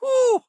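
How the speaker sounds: high, loud exhaling whoop; relieved whoop. Acted. Crew member relieved the danger is over, relieved whoop. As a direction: let out a big whoop of relief.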